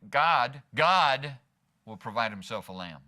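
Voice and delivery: strong emphasis on God; stress on GOD. Shown as a demonstration. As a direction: punch the word God, then let the line fall. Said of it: "like to put emphasis on different words".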